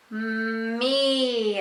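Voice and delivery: "Me" with a long mmm gliding into a long eee; slowly, without stopping. Shown as a demonstration. Teacher modeling slow words for a child, "Me" drawn out. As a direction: stretch "Me" out in one breath. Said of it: "Now I'll say me slowly".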